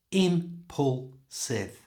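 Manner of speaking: "Impulsive" drawn out slowly, three parts; slow syllable by syllable. Shown as a demonstration. Teacher slowly modeling the word. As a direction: stretch "Impulsive" into three clear beats. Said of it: "Impulsive has three syllables".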